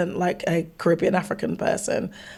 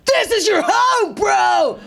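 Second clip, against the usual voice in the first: high, loud, hammed-up aggressive welcome; booming, high-pitched mock aggression; aggressive mock shout; 11 semitones higher, 4 dB louder, slower than usual. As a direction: shout it like an in-your-face New Yorker. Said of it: "New York was aggressive".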